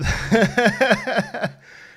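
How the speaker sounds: loud, high laugh while winded; breathless laugh. Real, not acted. Streamer laughing at being so unfit, breathless laugh. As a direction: burst into high, puffed-out laughter. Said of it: "I'm so out of breath".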